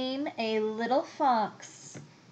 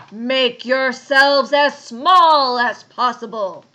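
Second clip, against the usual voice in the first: loud, slow, commanding bear voice; booming bear; 3 semitones higher, 16 dB louder, slower than usual. A narrator's reading. Bear ordering animals to squeeze up, booming. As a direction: boom out the order slowly.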